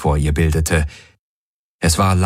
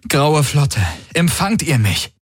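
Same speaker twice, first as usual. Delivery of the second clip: high, pressing radio call; urgent appeal; 8 semitones higher than usual. A narrator's reading. Man calls the Grey Fleet for a response, urgent. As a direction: call out to a fleet that is not answering. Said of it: "Er wiederholte seinen Appell".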